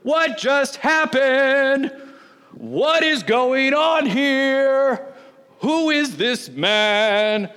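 slow, drawn-out bewilderment; a synagogue crowd reeling after an exorcism; stunned crowd. Retold or imitated. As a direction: let each question hang in shock. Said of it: "they're the ones who go".

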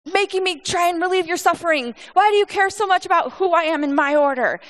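a booming, irritated God snapping at Job; angry God, read wrong. Retold or imitated. The wrong way to read God answering Job: angry, high and loud. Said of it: "when you read it wrong, it sounds like God is going".

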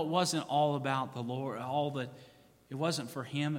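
ordinary talk, nothing marked

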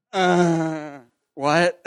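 loud, high, drawn-out disbelief; hammed-up baffled reaction; dumbfounded. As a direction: let out a big, high, stunned response. Stunned reaction to mentor's question, retold.

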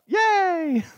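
high squealed yay of mock delight; playful cheer. Pastor cheering his own made-up happy ending, playful squeal. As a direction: cheer it like a kid hearing good news.

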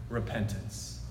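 quiet emphatic answer; hushed key word. Real, not acted. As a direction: drop the voice to land it. Soft punch word.

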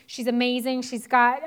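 ordinary talk, nothing marked

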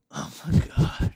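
breathy whispered awe; whispering. Real, not acted. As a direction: whisper it, amazed. Whispered amazed reaction.

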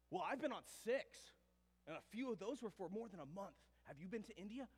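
puffed-up, loud bragging, pitched high; one-up them like an offended classmate; mock boastful retort, loud and insistent; super defensive. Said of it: "he got super defensive".

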